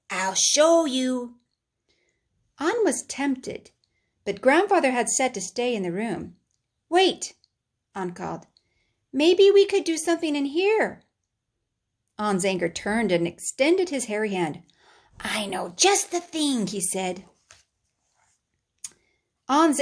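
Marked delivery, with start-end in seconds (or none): 0.1-1.4 anger creature. Acted. 6.9-11.0 put-on boy calling. 15.2-16.7 anger creature. Acted.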